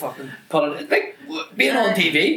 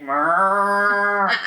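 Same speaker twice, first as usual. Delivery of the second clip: drawn-out, higher "mrrr"; animal noise; 7 semitones higher, 4 dB louder than usual. Retold or imitated. Pupil disrupting history class, long animal noise. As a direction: moo it out like livestock. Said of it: "I would do a different animal noise".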